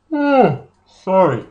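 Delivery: oh pulled high through a yawn; words stretched by a yawn. Real, not acted. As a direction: talk through a big yawn. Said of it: "getting a yawn here".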